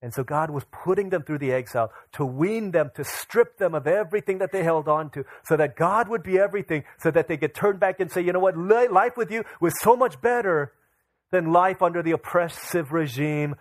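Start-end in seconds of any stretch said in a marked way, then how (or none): 3.6-13.5 preaching swell. Acted. Preaching swell before quoted repentance.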